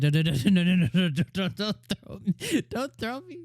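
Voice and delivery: retelling Usato begging Rose not to throw him, mock panic; mock panicked pleading. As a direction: plead like you're about to be tossed into the forest. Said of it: "He's like".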